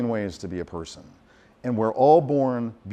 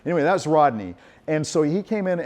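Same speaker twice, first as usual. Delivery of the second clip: ordinary delivery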